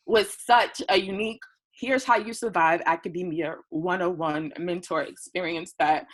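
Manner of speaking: usual speaking voice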